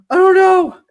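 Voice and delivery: put-on high, loud child wail; mock-wailing son; wailing kid. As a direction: belt it like a distraught boy. Son panicking, voiced.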